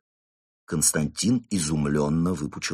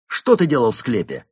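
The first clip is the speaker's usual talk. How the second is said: loud, raised interrogator's demand; sharp question; 4 semitones higher, 8 dB louder than usual. A narrator's reading. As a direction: fire the question at the suspect. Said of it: "резко спросил инспектор". (резкий допрос)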